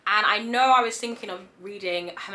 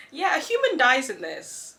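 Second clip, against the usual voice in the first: usual speaking voice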